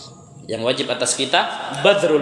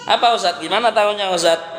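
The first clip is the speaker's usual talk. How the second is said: high, surprised asking; puzzled question; 5 semitones higher than usual. Retold or imitated. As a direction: ask it amazed, voice raised. Listener asks puzzled. (bertanya heran dengan suara tinggi)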